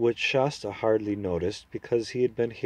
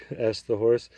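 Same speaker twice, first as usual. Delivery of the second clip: ordinary delivery, nothing marked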